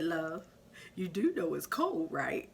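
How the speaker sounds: lightly voiced husband, cool and composed; calm and cool husband. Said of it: "just as calm and cool like he normally does".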